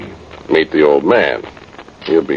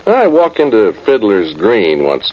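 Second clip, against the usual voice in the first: normal talking voice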